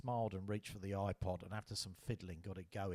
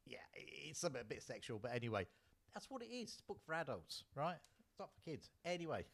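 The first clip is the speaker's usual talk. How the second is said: usual speaking voice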